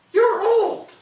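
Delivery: the wife's high, loud verdict. Acted. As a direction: blurt it out high and loud as the wife. Wife voiced in folk tale.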